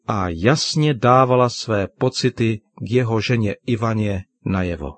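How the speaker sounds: ordinary delivery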